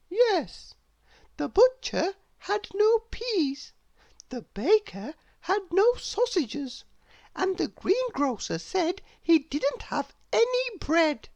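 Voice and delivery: high princess voice, innocently explaining; bright and sure of herself. Acted.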